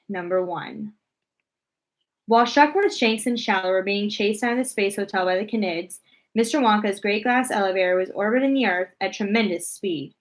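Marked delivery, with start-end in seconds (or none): none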